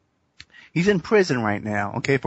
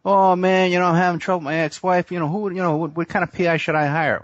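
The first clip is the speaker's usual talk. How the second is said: higher-pitched, hurried chatter of a troubled golfer; imagined O J; 4 semitones higher, faster than usual. Pretend. Golfer confiding in his partner about ex-wife trouble, imagined higher voice. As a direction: put on a higher voice, pretending to confide. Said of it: "O J shooting, playing golf with Clinton".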